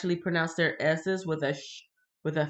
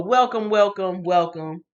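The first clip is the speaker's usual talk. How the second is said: warm loud welcome; 7 dB louder than usual. Real, not acted. Welcoming a viewer.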